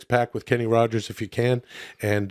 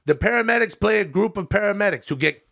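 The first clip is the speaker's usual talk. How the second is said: lightly voiced chummy comic reviewer; folksy buddy-to-buddy review, a little put on; Adam Sandler; 6 semitones higher than usual.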